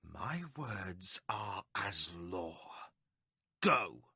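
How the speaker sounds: low, soft, slow snarl then a sharp Go. A narrator's reading. Milimber snarling at the hesitating guard. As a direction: menace held under the breath. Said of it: "Milimber spoke through a snarl".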